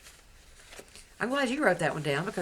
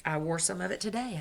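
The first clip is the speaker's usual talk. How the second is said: ordinary delivery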